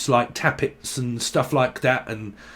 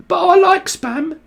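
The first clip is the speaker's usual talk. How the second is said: much higher and louder, gleeful; excited squeal; 6 dB louder than usual. Real, not acted. Confessing her love of spam to viewers, excited high squeal.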